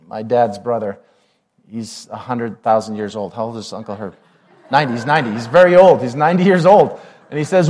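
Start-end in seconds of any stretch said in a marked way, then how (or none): none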